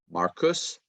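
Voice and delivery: ordinary talk, nothing marked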